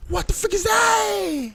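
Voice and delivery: high, slow, squeaky panic; panicked squeal. Real, not acted. Player staring at a monster, squeaking in high panic. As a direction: drag the question out in a terrified squeak.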